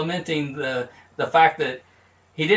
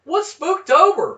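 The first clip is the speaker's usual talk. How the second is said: goofy high voice; 12 semitones higher, 4 dB louder, slower than usual. Acted. Goofy skit character asking.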